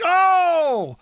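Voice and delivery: sudden high yelp of pain; pained cry. Retold or imitated. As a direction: cry out as the lorry jolts. Jolted lorry, clasps legs.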